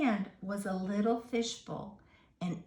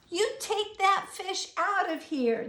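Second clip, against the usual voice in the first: angry yell; 10 semitones higher, 4 dB louder than usual. Acted. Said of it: "they yelled".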